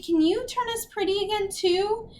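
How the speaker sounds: high, whiny begging; tearful plea. A narrator's reading. Beatrix asks Agatha to make them pretty, tearful. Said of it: "Beatrix said tearfully".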